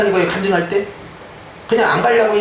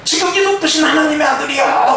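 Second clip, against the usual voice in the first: demon crying out; 8 semitones higher than usual. Retold or imitated. Demon-possessed man crying out.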